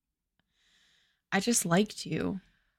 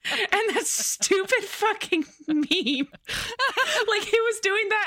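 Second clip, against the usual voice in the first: higher, laughing, slowed at first; real laughter recalling the meme; 9 semitones higher than usual. Real, not acted. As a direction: laugh through the memory.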